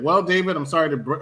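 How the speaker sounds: ordinary talk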